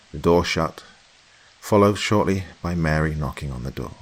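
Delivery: plain reading voice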